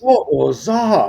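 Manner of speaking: high-pitched ghost-hunter panic; hammed-up startled investigator; mock alarm. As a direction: make a big show of hearing something.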